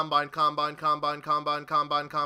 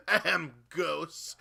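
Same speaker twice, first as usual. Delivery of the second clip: fake cough; 5 semitones lower, much slower than usual. Sarcastic fake cough jab.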